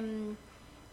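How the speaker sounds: ordinary delivery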